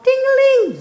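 very high, loud ting-a-ling; bell imitation. A narrator's reading. Froggy pedals like mad, loud high bell. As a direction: ring it out like a bike bell.

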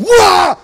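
high, very loud mock wail-roar; explosive retort. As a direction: blast back in a hammed-up yell. Wife's retold explosive reply.

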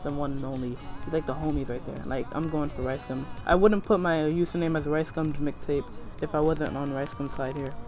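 ordinary delivery

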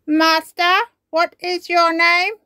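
the robot wakes and greets Ben. Acted. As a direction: speak like a toy robot. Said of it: "says the robot".

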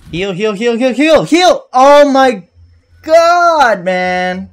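frantic rising yells; raging gamer. Real, not acted. Desperate healing mid fight.

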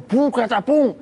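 loud mock boom; cartoon explosion sound; explosion noise. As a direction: blast it out like an explosion.

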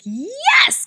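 high squeaky mock enthusiasm; excited yes. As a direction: jump at it gleefully. Husband's excited answer.

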